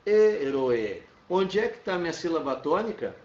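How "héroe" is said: Héroe said in Spanish, a little louder; teacher repeating Spanish Héroe; Spanish pronunciation. Shown as a demonstration. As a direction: say Héroe showing its sounds. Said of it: "a separação é até, é pelo som".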